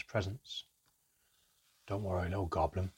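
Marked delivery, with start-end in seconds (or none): 1.9-2.9 gruff whisper. A narrator's reading. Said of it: "he whispered gruffly".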